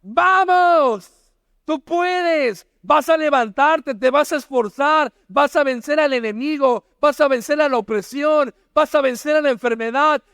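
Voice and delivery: agudo y fuerte, una arenga que no para; Dios gritando ánimo. Acted. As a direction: Dios animando a su siervo como un entrenador. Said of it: "¿Qué te está gritando?". (Dios grita ánimo al creyente, muy agudo y con fuerza)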